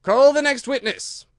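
very high, loud summons; ringing royal command; calling out. Acted. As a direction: call out the order to the court. King calls out in court.

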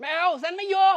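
high, loud protest; loud refusal. Acted. Sister loudly refuses to move in together. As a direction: shout it down flat.

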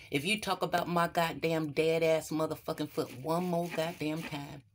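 normal talking voice

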